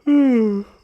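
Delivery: big audible yawn. Real, not acted. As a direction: let out a long yawn. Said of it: "I'm yawning into the microphone".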